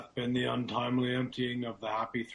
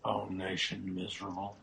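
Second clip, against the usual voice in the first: ordinary talk, nothing marked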